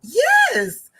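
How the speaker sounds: high, loud drawn-in yes; pitched-way-up retold "yes"; imitated answer. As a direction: answer startled by how it was asked. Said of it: "'cause the way she said it".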